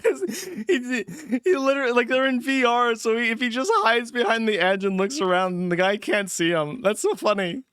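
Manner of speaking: high, loud, laughing through words; cracking up while describing it. Real, not acted. Laughing at hiding player.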